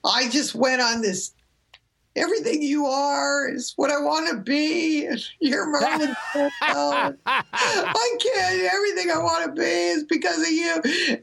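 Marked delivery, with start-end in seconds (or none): 2.2-11.2 retold crying outburst. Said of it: "I literally just burst out crying".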